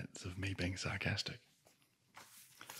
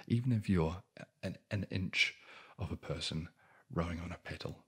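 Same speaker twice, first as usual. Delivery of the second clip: ordinary delivery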